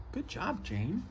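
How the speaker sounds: quiet, gentle good job; soft praise. Acted. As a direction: praise her softly, almost under your breath. Praising each scientist softly.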